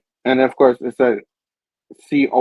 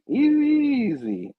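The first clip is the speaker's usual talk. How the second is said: mock placating; 8 semitones higher, 5 dB quieter, slower than usual. Spidey calming the Goblin before a fight, mock placating. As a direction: stretch the first word up high, settle on the second. Spidey trying to calm an attacker.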